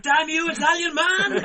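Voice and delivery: high, loud melodramatic yell; mock cursing shout. Man curses the Italian vanished behind a tree, hammed-up shout. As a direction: curse a vanished foe at full volume.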